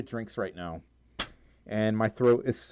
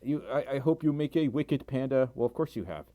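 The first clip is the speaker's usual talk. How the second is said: normal talking voice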